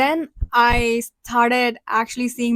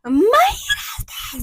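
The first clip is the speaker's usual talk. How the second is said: gasp, then very high drawn-out exclamation; retold amazement; 9 semitones higher, slower than usual. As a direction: re-enact a shocked realisation, high and stretched. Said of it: "and I was like".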